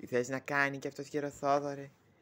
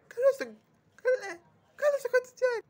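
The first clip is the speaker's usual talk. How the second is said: very high, loud squeal of welcome; squealing greeting; 6 dB louder than usual. Acted. Greeting an arrival, in a high squeal. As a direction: shriek the welcome in a piercing put-on voice.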